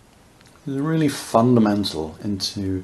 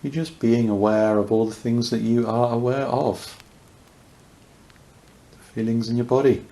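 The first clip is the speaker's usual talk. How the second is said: unhurried meditation voice with long pauses; guided meditation; faster than usual. Real, not acted. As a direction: guide listeners gently into awareness, leaving space between phrases.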